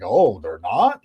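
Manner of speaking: low, sarcastic feigned surprise; mock surprise. Host mocking a guest's playoff doubt with low sarcastic surprise. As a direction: fake shock, deadpan and low. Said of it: "I'm like".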